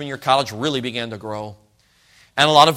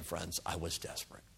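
hushed confession; 8 semitones lower, 22 dB quieter than usual. Real, not acted. Preacher confiding to the congregation in a whisper. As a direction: drop to a whisper on desperate.

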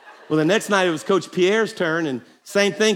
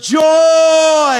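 much higher, much louder shout; shouted joy; 8 semitones higher, 9 dB louder than usual. Real, not acted. Pastor calling out to everybody, a big ringing shout. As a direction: belt it out to the whole room.

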